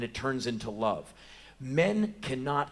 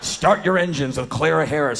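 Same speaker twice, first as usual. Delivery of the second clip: TV show title announcement; 3 semitones higher, 9 dB louder than usual. Acted.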